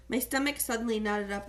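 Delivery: plain reading voice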